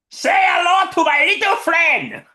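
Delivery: high, loud, hammed-up gangster shout; Tony Montana. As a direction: yell it like a Scarface movie quote. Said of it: "many great quotes from Scarface".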